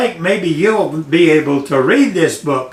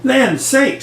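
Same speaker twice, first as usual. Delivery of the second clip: higher-pitched mama bear exclamation; middle-sized Mama Bear; 7 semitones higher than usual. A narrator's reading. Mama Bear on coming home, middle-sized higher voice. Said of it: "in her middle-sized voice".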